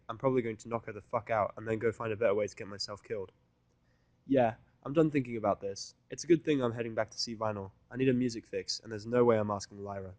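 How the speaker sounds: ordinary delivery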